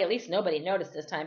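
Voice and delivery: usual speaking voice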